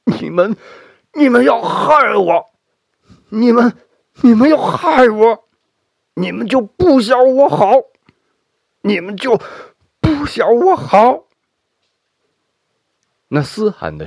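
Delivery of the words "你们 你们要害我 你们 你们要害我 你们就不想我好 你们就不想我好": crying and cursing. Acted. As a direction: wail and curse through tears. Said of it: "边哭边骂".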